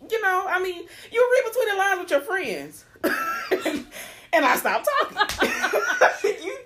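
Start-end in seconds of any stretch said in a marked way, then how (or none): 3.0-4.3 high laughing burst; laughing at the memory; burst of laughter. Real, not acted. Laughing while telling a friend the story. 5.5-6.4 high loud laughing; cracking up hard; loud laughter. Real, not acted. Cracking up while retelling the argument to friends.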